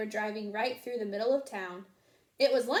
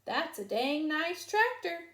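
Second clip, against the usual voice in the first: farmer drawl; 6 semitones higher, slower than usual. A narrator's reading. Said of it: "Farmer Odell observed".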